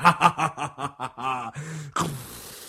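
staccato villain laugh; gloating monster laugh. Acted. Giant gloats after swallowing bully.

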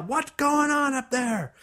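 high, drawn-out parental yell; parents yelling. Acted. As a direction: yell up the stairs, pitched high. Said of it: "Your parents yell".

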